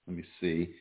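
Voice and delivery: normal talking voice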